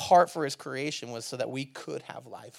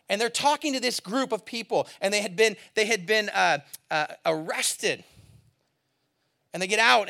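higher and louder, driving the story; impassioned preaching; 8 semitones higher, 9 dB louder than usual. Acted. Pastor retelling Peter before the crowd, impassioned preaching. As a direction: preach it like the crowd is in front of you. Preaching Acts chapter three, heated.

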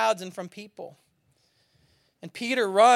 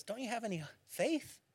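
much softer acted question; quiet challenge; 16 dB quieter than usual. Jesus answering alarmed disciples in the boat, quietly challenging. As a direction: drop to a hush for the question.

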